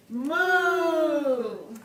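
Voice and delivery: a long, loud moo; cow moo. Shown as a demonstration. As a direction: moo along with the kids. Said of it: "Do it with me".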